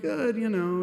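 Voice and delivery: a hint of the grieving daughter, bright and brave; lightly voiced woman on the phone; daughter's voice. Kelly retold on the phone.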